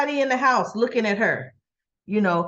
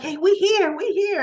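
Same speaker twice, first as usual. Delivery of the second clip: higher-pitched happy mimicry; happy, excited; 7 semitones higher than usual. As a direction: act out a cheerful arrival. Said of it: "all happy, like".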